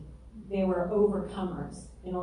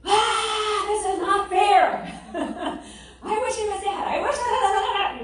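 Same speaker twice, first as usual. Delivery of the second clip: high, loud mock venting of grief; mock grief outburst; 14 semitones higher, 6 dB louder, much slower than usual. Imitating herself venting grief to God, high and loud. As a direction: pour it out like a raging child. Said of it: "I poured out all my grief".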